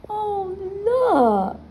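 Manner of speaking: high excited exclamation. Real, not acted. Showing kids the picture, excited. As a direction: point it out with sudden delight.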